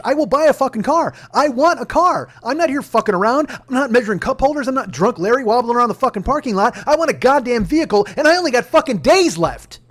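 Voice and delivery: re-enacted rant, high and very loud; frustrated outburst. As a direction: hammer out every demand, fed up. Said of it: "I'm getting frustrated".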